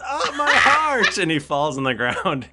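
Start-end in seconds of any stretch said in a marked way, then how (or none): 0.0-1.2 high, loud, drawn-out cry; hammed-up heart attack; satyr feigning collapse. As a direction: clutch at the heart and wail it slowly. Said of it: "And then he goes".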